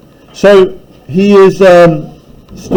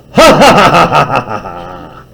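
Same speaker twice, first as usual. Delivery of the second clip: savage mock laugh, falling away; 4 semitones lower than usual. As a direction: cackle savagely then fade. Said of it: "snarled into a savage laugh".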